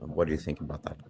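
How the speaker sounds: ordinary talk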